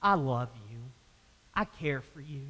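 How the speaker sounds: soft, tender father; a father's quiet loving reply; gracious. Retold or imitated. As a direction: reassure the son gently. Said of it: "It's a very gracious response".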